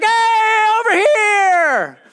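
very high, drawn-out holler. Acted. Blind man calling Jesus over to him, shouting. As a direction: holler for attention, stretching the words. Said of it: "He just shouts more".

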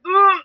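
a sharp, high "ugh" of disgust; disgusted groan. Retold or imitated. Recalling the kill scene to her co-host, high disgusted ugh. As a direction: groan like you saw it coming. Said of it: "as soon as he did, I was like".

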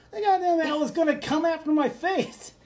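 high-pitched, panicked protest; retold panic. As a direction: blurt it out high, acting out the scare. Retold panicked reply to his wife.

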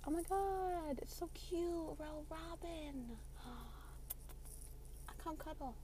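very soft, high squeal of delight; hushed cooing. Real, not acted. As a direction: coo so the little bird won't fly off. Cooing over a cute robin.

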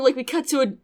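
ordinary talk, nothing marked

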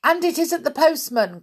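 high, loud correction; crying out. A narrator's reading. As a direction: chime in with a high cry. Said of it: "cried Molly".

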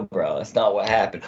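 ordinary talk, nothing marked

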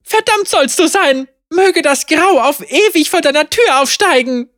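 high, loud, spitting rage; furious curse. Acted. Tamara curses Caitlin after her work burns, furious. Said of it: "spuckte sie Caitlin wutentbrannt vor die Füße".

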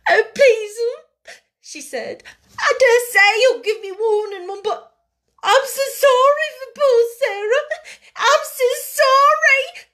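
high, loud sobbing maid's voice; tearful pleading. A narrator's reading. Scullery maid begging her mistress, sobbing. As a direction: weep the apology out in a high voice. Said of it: "She burst into fresh tears".

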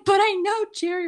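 high, loud mock plea; pleading Elaine. Elaine begging the doorman, high and loud. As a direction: squeal it like Elaine begging at the door.

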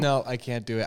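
ordinary talk, nothing marked

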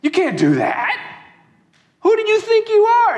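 scandalized guests shouting at Jesus; outraged protest. Acted. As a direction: build from shock to a furious challenge. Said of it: "And they all just go".

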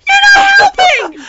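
very high, loud contradiction; exasperated scream. Retold or imitated. Paddler screaming back at Grandma, exasperated. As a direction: shriek it at a useless helper.

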